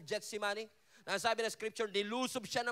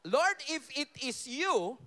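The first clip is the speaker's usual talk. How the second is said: slow, louder and higher, skeptical; demanding Peter; 4 semitones higher, 5 dB louder, slower than usual. Acted. Preacher voicing Peter asking the Lord for proof, slow and loud. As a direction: drag it out, wanting proof.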